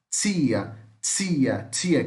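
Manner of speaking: standard Brazilian "tia" said as chia; standard Brazilian accent. Shown as a demonstration. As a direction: soften the t of "tia" to ch, Rio style. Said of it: "em outras partes aí do mundo, vai falar".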